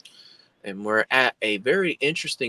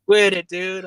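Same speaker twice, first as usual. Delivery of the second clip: higher, louder mock protest; retold yell; 9 semitones higher, 8 dB louder than usual. Retelling friend yelling to stop the prank, mock yell. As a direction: imitate an indignant friend yelling. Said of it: "he's yelling at me".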